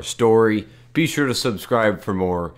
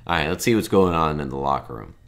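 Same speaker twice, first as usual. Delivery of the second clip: ordinary talk, nothing marked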